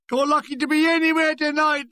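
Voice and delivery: high-pitched jeer from the crowd; heckling voice. A narrator's reading. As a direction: call out like a heckler. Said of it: "a voice".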